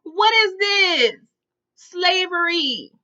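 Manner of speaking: slow, high, loud comic outrage; outraged disbelief. Real, not acted. Storyteller objecting to kids working, slowly outraged. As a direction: stretch the words out loudly and incredulously. Outraged at children made to work.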